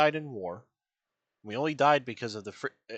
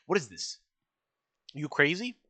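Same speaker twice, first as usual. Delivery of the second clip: ordinary delivery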